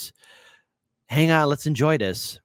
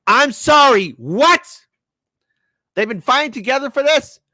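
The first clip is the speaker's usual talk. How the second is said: higher and louder, stunned; shocked outburst; 11 semitones higher, 7 dB louder than usual. Real, not acted. Host reacting to Biden praising Bernie, stunned and loud. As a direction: blurt it out in disbelief.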